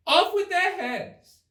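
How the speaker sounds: high, slow imperious command; queen's order. A narrator's reading. Queen sentences the gardeners, high slow command. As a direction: pronounce the sentence grandly.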